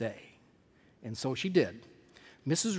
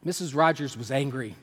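usual speaking voice

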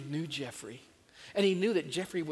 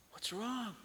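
gentle concern; 5 semitones higher, 5 dB quieter than usual. A narrator's reading. Father finds son crying.

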